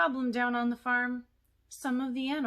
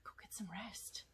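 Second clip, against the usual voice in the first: whisper; 15 dB quieter than usual. Acted. Whispers puppet off to bed.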